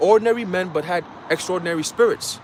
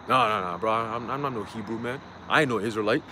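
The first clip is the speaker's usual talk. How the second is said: low, dismissive voice of a doubter, a bit quieter; mock denier voice; 8 semitones lower, 6 dB quieter than usual. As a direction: brush it off like a scoffer, "I ain't no Israelite". Mocking a denier of Israelite identity.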